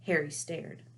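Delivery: plain reading voice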